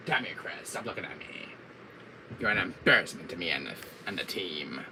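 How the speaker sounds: low, soft villain musing; brooding villain grievance; Wesker. Acted. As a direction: grumble as an arch-villain. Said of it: "getting into my Wesker fever".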